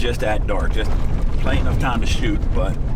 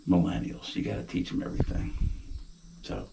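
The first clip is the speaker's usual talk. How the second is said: hushed whisper in the deer blind; 11 dB quieter than usual. Real, not acted. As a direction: keep it to a whisper so deer won't hear.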